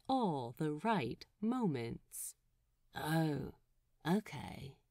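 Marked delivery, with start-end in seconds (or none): 2.9-4.8 soft hushed oh okay; whispered realization. A narrator's reading. Pete realizing to Lucius, soft hushed whisper. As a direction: murmur it so only Lucius hears. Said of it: "Pete whispers".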